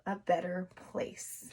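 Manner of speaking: a better place whispered; whispered ending. Shown as a demonstration. Teacher to kids, whispered sentence end. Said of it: "here's how it goes".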